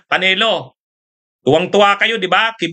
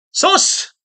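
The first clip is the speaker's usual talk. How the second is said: scoffing; 12 semitones higher than usual. Real, not acted. Scoffing at critics' complaint.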